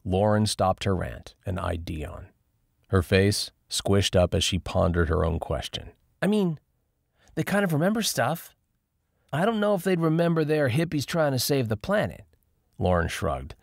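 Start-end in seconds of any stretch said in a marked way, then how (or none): 6.2-12.3 put-on higher young woman, rambling in disbelief; Lauren's voice, rattled. Lauren to friends in the fort, high and disbelieving. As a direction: voice her like she can't take it in. Lauren ponders vegan zombies.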